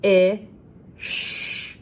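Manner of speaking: "I" loud and short, then "sh" as a breathy hiss; sounding out. Shown as a demonstration. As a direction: chop the word into sounds, "I" then "sh". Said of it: "sound out the word fish by chopping it up".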